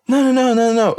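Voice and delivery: high-pitched panicky no's; flustered denial. Retold or imitated. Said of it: "And I was like".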